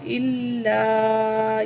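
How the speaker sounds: إِلَّا slowly with doubled l and the last aa held four counts; long madd. Shown as a demonstration. Teacher shows the four-count stretch to students. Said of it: "یہ بڑی مد ہے، کھینچ کے پڑھیں گے چار الف کے برابر".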